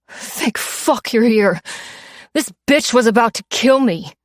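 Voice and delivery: whiny relief. A narrator's reading. Mugger pleads to bouncers.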